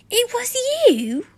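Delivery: high, loud shocked yell. Acted. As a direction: cry it out in accusing shock. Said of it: "I yelled".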